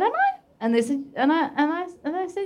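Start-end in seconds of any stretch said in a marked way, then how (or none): 0.0-0.4 much higher, incredulous little squeak; a startled would-be comic asking am I; surprised squeak. Retold or imitated. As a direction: squeak it out in disbelief. Retold surprised reply.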